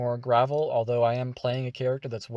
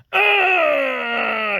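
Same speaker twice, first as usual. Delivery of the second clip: loud, high strained grunt; constipated grunt; 12 semitones higher, 8 dB louder than usual. Acted. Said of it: "just grunting".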